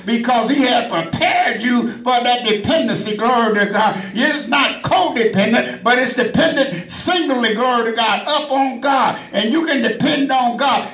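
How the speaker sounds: ordinary delivery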